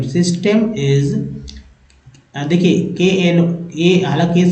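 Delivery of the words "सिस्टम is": "सिस्टम" को धीमे खींचकर; slow demo. Shown as a demonstration. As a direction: spell out "सिस्टम" slowly. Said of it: "टी एम को टेम". (धीमा उच्चारण "सिस्टम")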